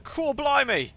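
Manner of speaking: a London exclamation, nan style; imitating his London nan's exclamation in a London accent. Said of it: "exactly what she sounded like".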